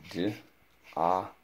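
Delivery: D-A-L-L sound by sound, with gaps. Shown as a demonstration. Teacher repeats doll's sounds for pupil, sounding out. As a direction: say each sound in D-A-L-L separately. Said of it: "the next word is the word doll".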